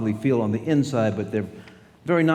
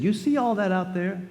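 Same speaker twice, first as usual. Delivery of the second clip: smiling mother; 8 semitones higher than usual. Retold or imitated. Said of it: "and she smiled, and she said".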